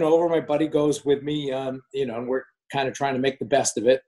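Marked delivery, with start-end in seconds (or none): none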